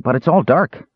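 high-pitched mild exclamation; a touch of kender alarm; crying out. A narrator's reading. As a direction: pipe up high with the news. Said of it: "cried Earwig".

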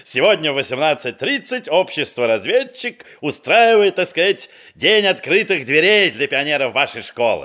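приторно вежливо, выше обычного; подлизаться к учительницам; слащаво-любезно. Acted. Льстивый визит к учительницам. (заходит к учительницам, слащаво-любезный тон)

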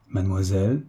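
a slight falter on Mademoiselle, caught off guard; hesitant, tongue-tied Mademoiselle; stammering. A narrator's reading. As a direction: stumble over Mademoiselle as if embarrassed. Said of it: "balbutia d'abord Olivier".

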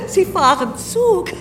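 high, loud mocking laughter around the words; laughing in disbelief. Billionaire on the phone mocks the narrator's train trip, laughing loudly.

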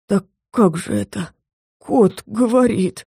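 slow, stunned, faint voice; dazed bewildered man. A narrator's reading. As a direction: barely get the words out in shock. Dazed man sees talking cat.

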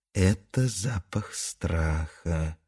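soft, slow whisper. Acted. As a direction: whisper it back gravely. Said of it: "шепнула Лапка в ответ".

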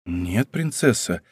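low, soft, slow answer; troubled, holding it down; agitated but restrained. A narrator's reading. Agitated count calming the princess's anger, restrained. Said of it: "отвечал взволнованный граф".

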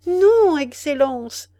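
high, loud desperate begging; pleading in terror. Acted. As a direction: beg for your life. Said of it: "paroles suppliantes".

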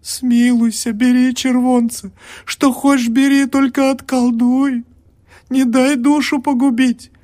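high, loud desperate pleading; begging. A narrator's reading. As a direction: beg him like your life depends on it. (больная умоляет студента, отчаянно и громко)

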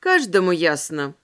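ordinary delivery, nothing marked

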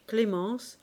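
ordinary delivery, nothing marked

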